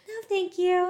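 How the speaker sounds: high, loud mocking sing-song; sing-songy. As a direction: sing it out high, mimicking a cringy refusal. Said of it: "In the most sing-songy voice".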